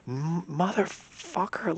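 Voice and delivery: high, drawn-out mock scream; half-mock scream of betrayal. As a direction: stretch the curse out in a retold yell. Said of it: "Like, I start screaming".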